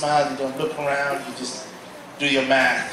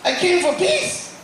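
high-pitched, startled wait; blurting it out in disbelief; a retold cry of surprise, pitched way up; dismayed protest; 13 semitones higher, slower than usual. Disappointed when prayer ends.

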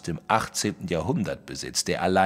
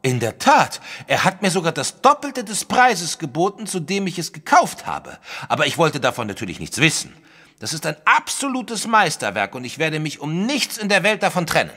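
excited boasting; 8 semitones higher than usual. Acted. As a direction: swell with pride over the painting. Wilson boasts about his masterpiece.

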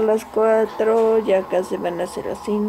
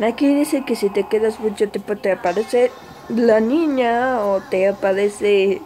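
ordinary talk

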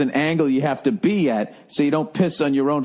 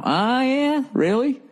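mock smug dealer; 9 semitones higher, slower than usual. Pilot salesman quoting price.

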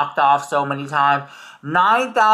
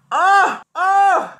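excited exclaim; 13 semitones higher, 3 dB louder than usual. Real, not acted. Excited about uses of money.

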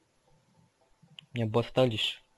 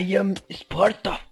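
movie quote; 5 semitones higher, slower than usual. Pretend. Citação de filme, amigo ri.

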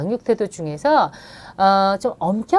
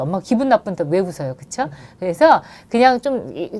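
normal talking voice

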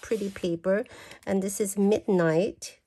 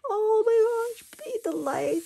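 very high, breathy gasp of joy; gasping delight. Real, not acted. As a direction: gasp at something lovely. Gasping at a paper.

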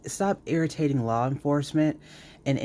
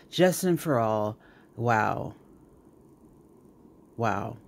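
ordinary talk, nothing marked

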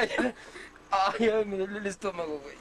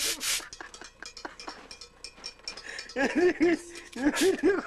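hysterical laugh; 6 semitones higher than usual. Real, not acted. Laughing fit at friend's gesture.

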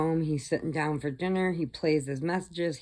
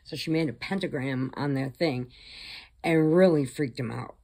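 ordinary talk

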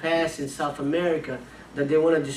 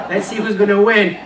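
laughing challenge; 5 semitones higher, 9 dB louder than usual. Retold or imitated.

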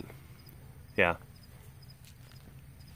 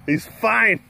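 ordinary delivery

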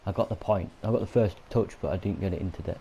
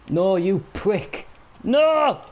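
loud, high drawn-out furious shout; raging gamer scream; anguished yell; 10 semitones higher, 6 dB louder, much slower than usual. Real, not acted. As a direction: howl it as the goal goes in. Goal conceded in game.

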